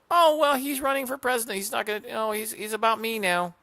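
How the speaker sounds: high, loud, naive mock voice; mock voter fooled by a candidate, high and loud; mocking gullible voter. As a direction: sneer it out as a dupe would. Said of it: "And go".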